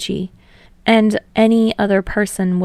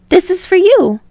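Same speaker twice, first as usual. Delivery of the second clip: high, louder, eager voice; eager offering; 12 semitones higher, 8 dB louder than usual. Acted. Hinata handing Tsukishima a drink, high and eager. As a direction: hold it out gladly.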